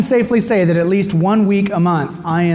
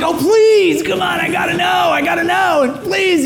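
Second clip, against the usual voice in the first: high, whiny begging; desperate pleading; 13 semitones higher than usual. Retold or imitated. Husband begging wife to learn the babies' sex, pleading. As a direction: beg like a desperate child, pitched right up.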